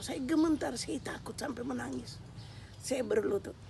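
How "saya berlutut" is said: normal talking voice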